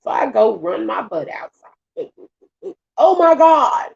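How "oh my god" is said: high, loud exclamation; retold shock. Spotting a snake outside the house, shocked. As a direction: cry out as if startled by a snake.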